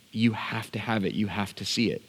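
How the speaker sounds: soft, understated insistence; hushed earnest plea. Retold or imitated. Dad offering son his one great father moment, quietly earnest. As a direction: press the point quietly.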